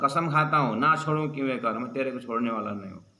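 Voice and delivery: ordinary talk, nothing marked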